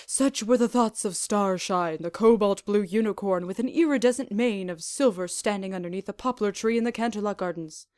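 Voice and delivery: plain reading voice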